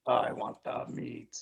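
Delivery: slow, voiceless creature rasp; weasely orc voice. Acted. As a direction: whisper it like a hungry little orc. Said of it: "funny part where he's like".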